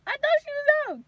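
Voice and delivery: very high, loud, fast protest; exasperated wail. Retold or imitated. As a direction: re-enact wailing in disbelief. Exasperated mother protesting, retold.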